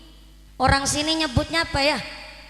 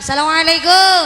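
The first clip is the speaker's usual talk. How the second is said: memanggil jamaah; 3 semitones higher, 8 dB louder than usual. Real, not acted. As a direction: hail the back rows. Calling to back rows for attention. (seruan salam keras ke barisan belakang)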